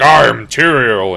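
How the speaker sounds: loud, slow proclamation; a hammed-up booming angel; grand Tyrael. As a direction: announce your true name. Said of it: "he's like".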